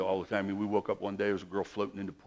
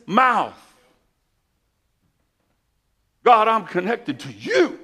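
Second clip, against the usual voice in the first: rising, louder preacher's cry, slow on the opening lines; preaching at full force; 9 semitones higher, 9 dB louder, slower than usual. Acted. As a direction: proclaim it to the congregation like a revival preacher.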